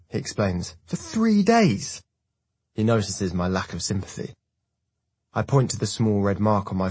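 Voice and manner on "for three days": whiny complaint. A narrator's reading. Hartman complaining to Bram.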